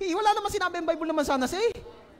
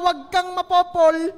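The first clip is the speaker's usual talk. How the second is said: mock warning; 4 semitones higher, 7 dB louder, slower than usual. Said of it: "Na-warning na".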